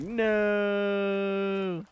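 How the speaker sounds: long, high drawn-out no; hammed-up wail, stretched right out; exaggerated denial. Hammed-up answer, retold plainly after.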